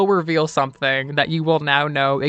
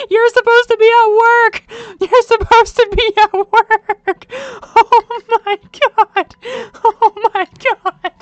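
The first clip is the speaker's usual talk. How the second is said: much higher, louder, laughing surprised shrieks; excited shout; 10 dB louder, slower than usual. Real, not acted. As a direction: greet your partner home with a delighted scream. Partner unexpectedly comes home.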